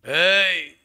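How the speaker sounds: loud, sharp shout; angry parent shout. Acted. Parent yells at child. (పిల్లాడిపై అరిచే తల్లిదండ్రులు)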